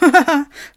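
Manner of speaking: high, loud burst of laughter; jeering laugh. Acted. Someone laughs at the Turkish bishop, jeering. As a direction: guffaw mockingly. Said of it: "lachte jemand".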